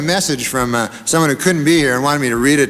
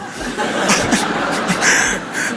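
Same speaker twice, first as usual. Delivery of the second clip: crowd laughter; 5 semitones higher than usual. Real, not acted.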